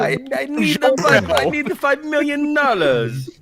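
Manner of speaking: giggling mock ransom demand; laughing demand.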